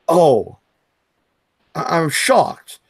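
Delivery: slow, fake astonishment; sarcastic shock. Real, not acted. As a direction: pretend to be stunned. Feigned shock over low views.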